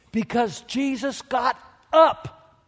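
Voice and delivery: loud, high-pitched build over the music; rising sermon climax, full voice; preaching at full force. Acted.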